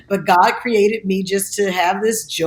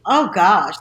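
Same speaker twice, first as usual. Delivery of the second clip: ordinary talk, nothing marked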